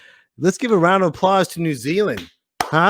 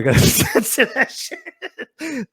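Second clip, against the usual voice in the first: high, words breaking into laughter; laughing; 11 semitones higher, slower than usual. Real, not acted. Host censoring a viewer's name, laughing. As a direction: laugh right through the line.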